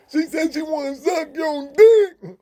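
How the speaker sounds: high, loud, slow deaf voice; high, slow, loud deaf voice yelling the message; deaf friend yelling. Retold or imitated. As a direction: bellow it high for the whole tier. Deaf friend yells again.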